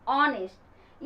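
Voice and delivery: ऑनेस्ट starting straight on the vowel; silent h. Shown as a demonstration. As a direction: say ऑनेस्ट firmly, dropping the h. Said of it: "हॉनेस्ट नहीं". (सही उच्चारण, ऑनेस्ट बिना ह के)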